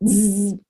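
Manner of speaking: loud imitated buzz; sharp zzz of a bee. Bee reaching his bum.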